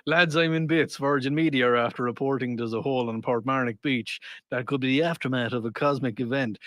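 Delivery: low Irish brogue, reading aloud; amused Irish storytelling lilt; Irish accent. Acted. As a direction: read it out as an Irish lad. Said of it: "in your accent".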